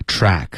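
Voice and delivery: TRACK stretched slowly on a broad "a"; wide-open vowel. Shown as a demonstration. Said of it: "啊的音嘴巴要张开". (示范：track 嘴巴张得特别大)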